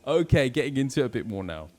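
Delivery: ordinary delivery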